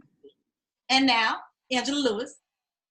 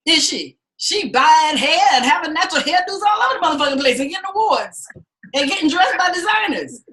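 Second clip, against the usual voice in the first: normal talking voice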